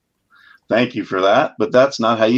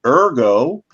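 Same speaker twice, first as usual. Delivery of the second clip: normal talking voice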